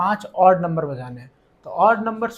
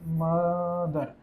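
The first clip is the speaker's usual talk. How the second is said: very slow, softer writing-along voice; drawn out; 5 dB quieter, much slower than usual. Real, not acted. Teacher saying a word as he writes it, drawn out. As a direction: stretch the word right out.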